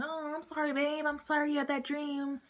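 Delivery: high, soft mock sympathy; cooing girlfriend. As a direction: imitate a girlfriend soothing her babe, high and soft. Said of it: "the girl was like".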